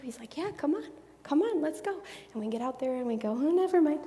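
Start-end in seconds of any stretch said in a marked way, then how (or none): 0.4-2.0 high, upbeat coaxing; eager encouragement. Acted. Jesus eagerly beckons them out. 3.3-4.0 nervous backing out. Acted. Timid follower backs out of walking.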